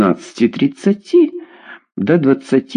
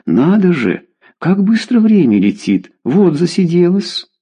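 put-on high, airy teenage wonder; naive surprise; 8 semitones higher than usual. A narrator's reading. Daughter amazed how time flew, naive surprise. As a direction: marvel innocently in a raised voice. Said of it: "наивно удивилась девчонка".

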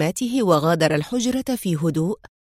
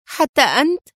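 high, sharply rising disbelief; indignant cry; 6 semitones higher than usual. A narrator's reading. Mona turning sharply on her friend, indignant cry. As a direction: exclaim it as a shocked, reproachful question. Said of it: "في حدة وهتفت مستنكرة".

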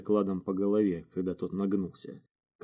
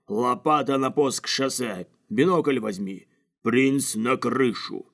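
louder, commanding yell; bellowed orders; 4 semitones higher, 5 dB louder, slower than usual. A narrator's reading. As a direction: roar orders at the whole gang. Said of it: "червь высунул в зал голову и тут же заорал". (командный ор)

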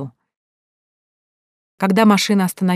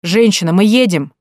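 louder, impatient driver; impatient taxi driver, louder; irritated driver; 2 semitones higher, 7 dB louder than usual. Acted. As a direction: hurry her up, annoyed. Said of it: "раздражённо поинтересовался водитель".

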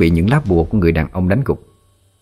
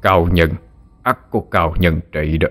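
taunting sorcerer; 3 semitones lower than usual. A narrator's reading. Sorcerer taunts the defeated man.

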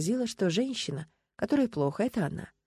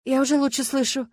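higher-pitched, mild protest; raised pitch, pleading to be believed; 6 semitones higher, 4 dB louder than usual. A narrator's reading. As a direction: object like an anxious patient. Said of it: "запротестовала Люба".

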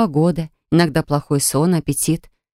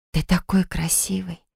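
put-on soft, cooing to a baby; tender baby talk; 6 dB quieter than usual. A narrator's reading. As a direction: coo it gently, nose to nose. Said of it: "сказала я".

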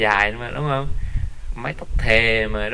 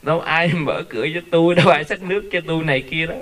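mock widow's whine; 6 semitones higher than usual. Widow's excuse, voiced by preacher.

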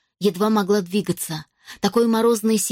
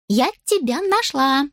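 put-on high, slow elf girl voice; 6 semitones higher than usual. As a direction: chirp it like a cheerful elf. Ghost girl finds Jade.

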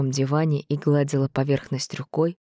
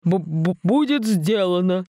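stammering; 4 semitones higher, slower than usual. Acted. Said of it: "проговорили парни".

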